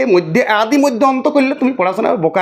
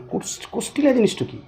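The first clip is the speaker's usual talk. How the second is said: puzzled question; 7 dB quieter than usual. Retold or imitated. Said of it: "নিয়ে বলি".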